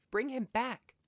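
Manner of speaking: high, loud desperate cry. A narrator's reading. Wife cries out to husband to bring son back. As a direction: cry out to him. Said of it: "cried the old woman".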